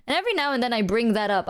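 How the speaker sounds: ordinary talk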